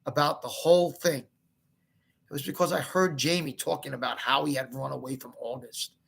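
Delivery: usual speaking voice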